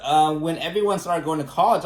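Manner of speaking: ordinary delivery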